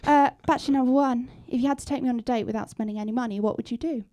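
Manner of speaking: timid quiet voice. Acted. The contestant [name]'s voice.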